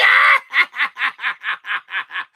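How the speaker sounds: loud, laughing shout; giddy laughing holler. Pretend. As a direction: break into a yelling laugh. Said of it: "try to scream as loud as I can".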